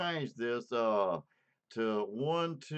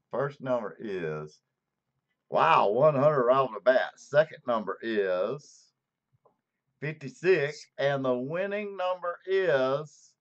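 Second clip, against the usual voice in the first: raffle caller; 5 dB louder than usual. Acted. Drawing raffle winner live.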